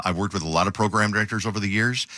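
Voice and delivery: ordinary talk, nothing marked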